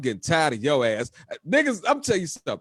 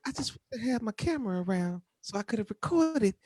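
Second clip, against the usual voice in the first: imitating her; 6 semitones higher than usual. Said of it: "she's like".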